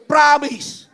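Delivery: very loud, high mock vow; emphatic shouted promise. Preacher shows neighbours how to swear it, emphatic shout. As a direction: punch it out like an oath. Has congregation repeat the vow.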